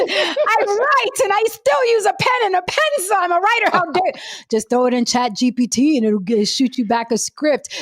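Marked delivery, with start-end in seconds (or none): 0.0-4.1 hammed-up offended artist, much higher; mock outrage. To Saint about the ChatGPT suggestion, hammed-up outrage. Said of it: "I was like".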